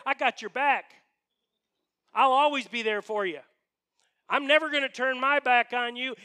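boastful promises belted out; Peter. Acted. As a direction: swear loyalty with big, overconfident force. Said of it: "Peter was kind of bragging on himself".

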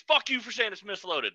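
mock angry lifter. An angry lifter confronting the handler, mock rage. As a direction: act out someone getting in your face. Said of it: "I don't ever want somebody to get up in my face".

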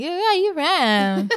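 high, drawn-out mimicry of the question; exaggerated imitation of a nosy stranger; mock nosy stranger. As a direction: stretch it out high, laughing. Mocking the tiring question.

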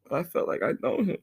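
crying, voice cracking up high and quiet; tearful break as he says he felt he knew him. Real, not acted. Podcaster breaking down in tears over a stranger's death, voice cracking. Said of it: "I gotta stop crying".

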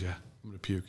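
low, very quiet mumbled confirmation; queasy admission. Retold or imitated. Sick friend confirming he will puke, low and feeble. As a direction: drop it low and soft, barely there. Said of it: "Dungeon Master goes".

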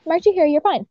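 high, breezy teacher voice; a light mock of an easygoing teacher; imitating the teacher. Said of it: "She's just like".

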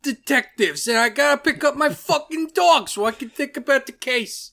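loud, high-pitched New York detective; New York accent. Acted. Comic takes off TV detectives at hot dog stands, brash New York accent. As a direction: bellow it like a hammy New York cop. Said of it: "my New York accent was bad".

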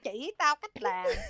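slow, commanding Vietnamese line; scary Vietnamese woman. Retold or imitated. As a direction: bark it like a stern Vietnamese auntie. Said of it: "you sound like a really scary Vietnamese woman".

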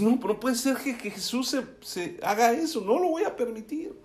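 high-pitched retold objection; protesting Pedro.

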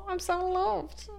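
high, slow, drawn-out coo; hammed-up melting sweetness; mock touched. Reacting to a sobbing sister, mock touched coo.

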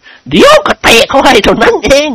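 high, drawn-out scolding; huffy character warning; mock threat. Lord threatens a kick.

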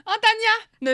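high, loud delighted call of Tania; excited. Real, not acted. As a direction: sing out Tania with glee. Calling friend's name.

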